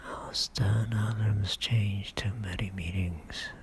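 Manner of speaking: voiced, unhurried stage declamation; measured soliloquy. Richard alone, recalling war turned to peace, measured and voiced. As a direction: recite it deliberately, pausing between phrases.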